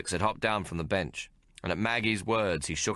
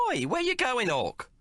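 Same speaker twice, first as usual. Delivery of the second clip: calling out. A narrator's reading. Maggie calling after Hawk leaving the pub. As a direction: shout after him. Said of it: "Maggie called out".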